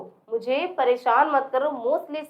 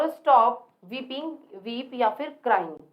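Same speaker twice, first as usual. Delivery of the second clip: slow modelling; much slower than usual. Shown as a demonstration. Teacher slowly modelling stop weeping for the class. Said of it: "teacher models English slowly".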